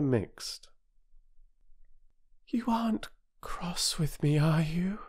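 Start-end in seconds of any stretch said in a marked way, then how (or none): 2.5-5.0 small, hushed whisper; a little girl's scared whisper. A narrator's reading. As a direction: whisper it timidly, afraid of the answer. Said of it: "in a very small voice".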